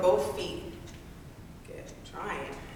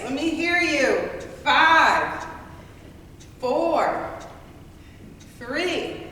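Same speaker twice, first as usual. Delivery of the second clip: high, loud fired-up counting; loud coaching shout; 6 semitones higher, 6 dB louder, slower than usual. Real, not acted. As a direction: pump the class up, shouting. Instructor shouting count, cheering class.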